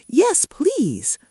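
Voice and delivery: high, eager little sprout voice; tiny sprout perking up. A narrator's reading. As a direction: brighten up a tiny bit, hopeful. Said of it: "perking up just a tiny bit".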